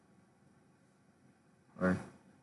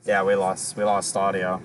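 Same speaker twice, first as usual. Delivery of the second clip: ordinary talk, nothing marked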